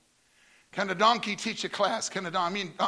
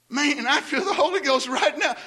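laughing joy; 6 semitones higher, 4 dB louder than usual. Retold or imitated. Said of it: "And as I said".

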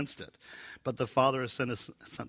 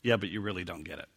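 dismissive aside; 5 semitones lower, 4 dB quieter, faster than usual. Shown as a demonstration. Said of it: "with the implication of".

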